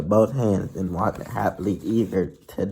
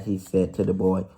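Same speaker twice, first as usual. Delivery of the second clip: ordinary delivery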